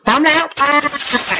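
high, drawn-out "ready, go" call; rallying call. Real, not acted. Teacher cueing pupils to read together, high rallying call. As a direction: call the class to start, stretching it out high.

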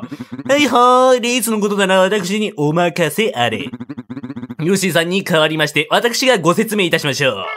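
bouncy announcer character, drawn-out opener; race host voice. Acted. Race host introducing himself to the players, eager announcer voice.

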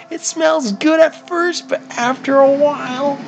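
very high squeaky put-on voice; mock high voice. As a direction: squeak it like a cartoon. Mock voice reading chat.

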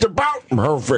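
slow, lightly voiced old man; doddering old man. Old man at ticket office.

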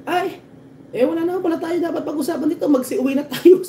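high-pitched, exasperated official giving up; mock exasperated official. Official tells everyone to go home, high mock exasperation. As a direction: throw up your hands in a high mock whine. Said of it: "lahat ng matataas sa posisyon".

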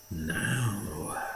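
a collector hushed with eagerness as the paper comes off; breathed. A narrator's reading. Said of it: "Maitland breathed".

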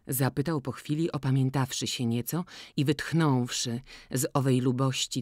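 ordinary delivery

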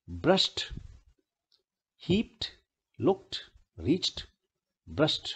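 "हीप्ड", "लुक्ड", "रीच्ड", "ब्रश्ड" each closing on t; one syllable per word, "heept, lukt, reecht, brusht". Shown as a demonstration. Teacher reviews the examples, -ed pronounced "t". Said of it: "ट बोला जा रहा है आखिरी".

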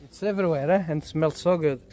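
ordinary talk, nothing marked

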